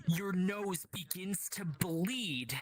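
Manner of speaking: drawn out, heavy narration; slow the words down with dread; slow ominous narration. Acted.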